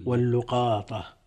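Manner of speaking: واللقاطة as wal-lu-qaa-ta, "u" on lam; damma on lam. Shown as a demonstration. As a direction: slowly say واللقاطة with lu and a long qaa. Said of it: "بضم اللام".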